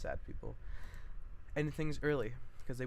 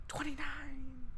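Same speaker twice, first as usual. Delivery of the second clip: high, slow exclaim; mock dismay; 13 semitones higher, much slower than usual. Real, not acted. Reading the script, a slow high mock exclaim. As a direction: groan at the page count.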